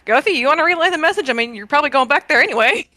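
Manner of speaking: imitating a casual ask. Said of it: "it's just trying to look like".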